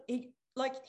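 normal talking voice

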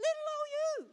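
put-on "Little old you" in a tiny high squeak; squeaky small voice. Shown as a demonstration. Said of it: "repeats with different voice".

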